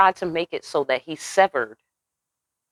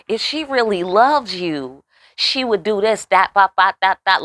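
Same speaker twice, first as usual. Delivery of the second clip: mock temptress; 5 semitones higher, 3 dB louder than usual. Mocking the woman's line.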